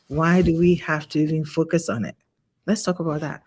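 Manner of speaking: ordinary delivery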